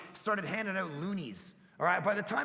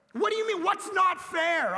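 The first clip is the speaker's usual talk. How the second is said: shouting back; 8 semitones higher, 4 dB louder than usual. Retold or imitated. Dad shouting back at the squabbling kids, loud and high. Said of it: "I shouted back".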